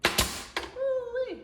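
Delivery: very high falsetto ooh-wee whoop; high hammed-up ooh-wee holler. Impressed whoop in accent.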